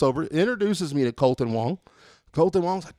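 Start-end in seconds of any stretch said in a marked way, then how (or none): none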